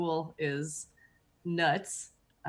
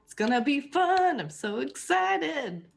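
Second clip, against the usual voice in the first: sarcastic excitement; 8 semitones higher, 4 dB louder than usual. Acted. School starting, sarcastic.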